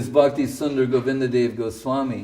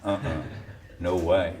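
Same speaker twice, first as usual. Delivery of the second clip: low, softer mock Australian protest; mock Australian voice, lower and softer; mock Aussie denial; 7 semitones lower, 7 dB quieter than usual. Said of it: "They go".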